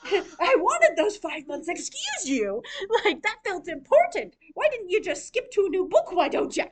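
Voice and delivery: high, loud mock outrage; indignant reader. Annoyed reader complaining to the writer about a time skip, loud mock indignation. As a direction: play an offended reader scolding the author.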